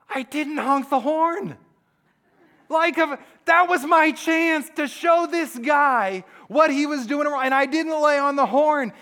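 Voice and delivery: high, loud frustrated complaint; indignant driver replaying the moment; mock exasperation. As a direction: wail over a missed chance, hammed up. Acting out frustrated driver's thoughts.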